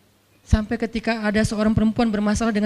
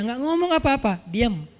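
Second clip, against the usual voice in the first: ordinary delivery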